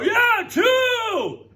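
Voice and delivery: high, loud hammed-up shout; mock cheer. Teacher acting out her shouted guess at the Mariners game. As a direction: shout the guess like a sure fan. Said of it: "I'll go".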